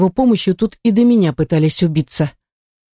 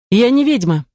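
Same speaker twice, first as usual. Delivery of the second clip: higher-pitched curt denial; curt retort; 6 semitones higher than usual. A narrator's reading. Artefactor denying to the house spirit she's a witch, curt. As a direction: cut the argument off sharply. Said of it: "обрубила в ответ".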